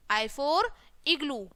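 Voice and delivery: ordinary delivery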